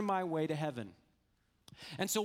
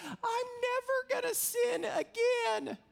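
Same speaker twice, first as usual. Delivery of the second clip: put-on very high, drawn-out squeaky girl voice; little girl; slower than usual. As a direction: imitate a little girl's slow, high-pitched vow. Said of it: "there was this little girl".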